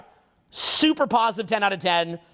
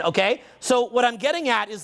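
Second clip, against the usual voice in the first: normal talking voice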